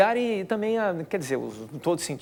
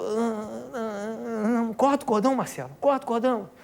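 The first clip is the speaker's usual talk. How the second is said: urging doctor; 8 semitones higher than usual. Retold or imitated. Doctor urges cutting the cord.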